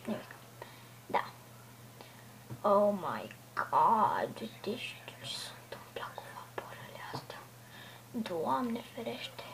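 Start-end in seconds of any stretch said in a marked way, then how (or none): 2.6-9.4 awed whisper. Real, not acted. Streamer showing viewers the ships, whispering in awe. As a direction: hush it like you can't believe your eyes.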